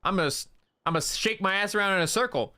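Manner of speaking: mocking Skinwalker echo. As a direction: parrot his own dumb line back, a touch hammed. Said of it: "Skinwalker that stole my voice and just kept saying".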